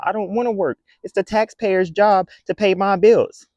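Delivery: high, loud entitled whine; mocking lazy whiner. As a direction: ham up the entitled complainer. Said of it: "cry about".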